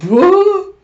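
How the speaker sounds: loud, very high squawk of disbelief; mock shock. Retelling Hinata's reaction to his partner, mock shocked yelp. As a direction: yelp it in exaggerated astonishment.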